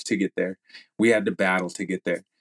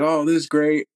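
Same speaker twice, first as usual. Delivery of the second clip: higher, lifted voice of happy surprise; retold delight; 7 semitones higher, 4 dB louder than usual. Athlete recalling the podium moment, retold delight. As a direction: relive the thrill, pitched up. Retelling his joy at the podium.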